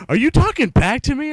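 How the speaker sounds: high, loud mock-outraged shout; flipping out. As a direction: imitate a teacher exploding at backtalk. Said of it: "And she flipped shit".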